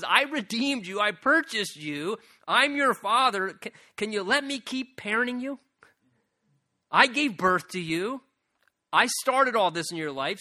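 high, loud fatherly appeal; preaching as God. Acted. God as a father asking to keep parenting, high and loud.

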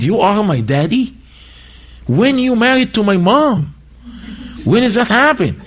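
scoffing, disbelieving questions, pitched high; mocking the prayer. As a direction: sneer at calling God daddy. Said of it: "mocking this prayer".

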